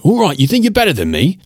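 high, fast sneering retort; mocking Brit. Heard from a stranger, a fast, high sneering retort. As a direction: scoff like a put-out Londoner. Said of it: "You'll get the comment".

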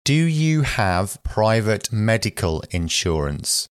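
ordinary talk

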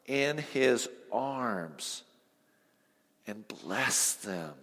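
tender hush over the scripture; tender reading. A narrator's reading. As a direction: read it like holding a baby. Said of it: "You talk about a tender moment".

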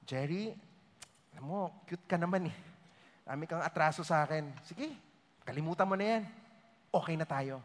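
low, soft, easygoing reassurance; gentle indulgent voice. Retold or imitated. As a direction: let him off the hook gently.

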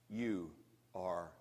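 quiet, calm and low, each word placed; a hushed, steady verdict. A narrator's reading. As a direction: prophet Nathan calmly tells David the truth. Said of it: "In a very calm voice".

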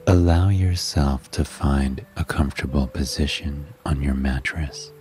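plain reading voice

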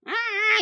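high mock tantrum cry, loud; imitated tantrum wail. Mom imitates daughter's tantrum to the teacher, high mock wail. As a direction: mimic a toddler flinging herself down with a wail.

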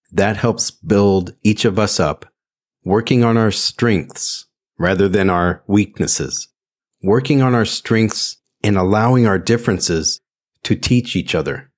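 normal talking voice

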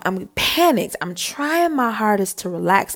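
ordinary delivery

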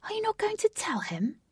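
high, lightly voiced conspiratorial whisper; a hint of a sly whisper; whispered aside. A narrator's reading. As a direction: lean in and whisper the secret. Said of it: "Jose whispered in Katie's ear".

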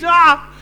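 loud, high cry from the neighbour; sudden high-pitched shout of horror; acted scream. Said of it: "your neighbor just shouts out, he screams".

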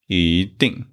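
一定 with 一 rising, 定 falling; 一 in second tone. Shown as a demonstration. Teacher demonstrates the second rule, 一 rising before falling 定. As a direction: lift 一 from mid upward, then drop 定. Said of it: "it changed to second tone".

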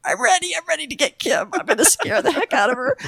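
high-pitched, eager self-talk; excited squeal of a prankster in hiding; gleeful anticipation. Retold or imitated. Flight attendant crouched in elevator to scare colleague, high gleeful anticipation.

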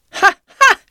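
loud, high "ha, ha"; two sharp bursts of mock laughter; cawing laugh. A narrator's reading. Doctor answering Ribby at the smithy, cawing laugh. Doctor's cackling bird-like catchphrase.

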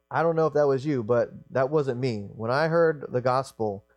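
normal talking voice

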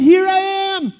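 high, meek little voice; wimpy voice. Acted. Shepherd answers the booming voice, wimpy voice. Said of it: "it was a little wimpier".

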